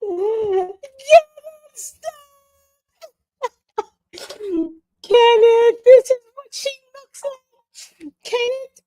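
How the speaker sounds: high, teary laughing voice; laughing until tears come; crying laughing. Real, not acted. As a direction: beg him to stop while cracking up. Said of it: "you're making me cry".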